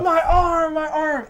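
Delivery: high, laughing hammed-up whine about the arm; mock whining son. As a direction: play the overdramatic hurt kid. Son's exaggerated pain.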